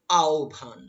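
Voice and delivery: আহ্বান said "ao-bhan", long first glide; correct pronunciation. Shown as a demonstration. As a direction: sound out আহ্বান as drawn-out "aao-bhaan". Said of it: "উচ্চারণটা কি হবে?".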